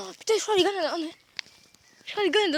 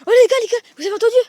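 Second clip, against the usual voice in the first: alarmed shouting; 4 semitones higher, 8 dB louder than usual. Real, not acted. Hears a noise, alarmed.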